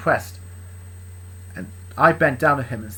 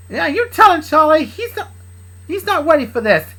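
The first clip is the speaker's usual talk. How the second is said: very high, loud excited cheer; cheering sidekick; 12 semitones higher, 8 dB louder than usual. Acted. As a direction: hype him up in a squeaky voice. Same cheering voice.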